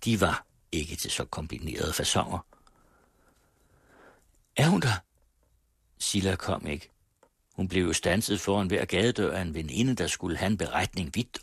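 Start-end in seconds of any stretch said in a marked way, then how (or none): 4.6-5.0 high, raised question; calling out. A narrator's reading. Mother calling out for the servant, high and raised. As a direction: shout it into the hall.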